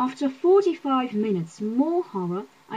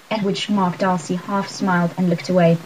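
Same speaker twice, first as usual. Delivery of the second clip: usual speaking voice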